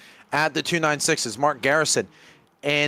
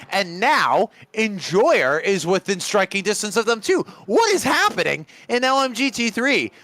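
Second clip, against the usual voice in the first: excited; 6 semitones higher than usual. Real, not acted. Commentator reacts to sudden race action.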